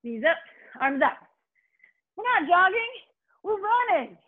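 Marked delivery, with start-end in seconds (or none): 2.2-4.2 excited. Real, not acted. Coach pushing pace.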